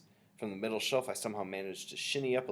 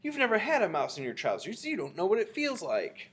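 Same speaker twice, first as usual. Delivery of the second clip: high, aggrieved protest; shouting back; 10 semitones higher, 4 dB louder than usual. A narrator's reading. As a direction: argue back indignantly. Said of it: "the man was shouting back".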